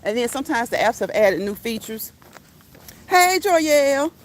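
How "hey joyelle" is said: excited greeting. Real, not acted. Greeting a viewer who joined.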